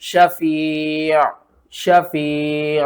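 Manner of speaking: शफी' stretched very slowly, with the final ain sounded clearly. Shown as a demonstration. Teacher demonstrates a clear ain in शफी' for students. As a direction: hold शफी' and let the ain be heard. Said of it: "किस तरह?".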